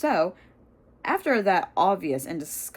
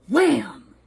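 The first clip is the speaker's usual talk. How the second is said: higher, louder burst; loud exclamation; 7 semitones higher, 7 dB louder than usual. A narrator's reading. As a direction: punch out the impact. Collision in the match.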